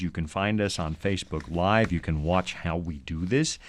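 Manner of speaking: ordinary delivery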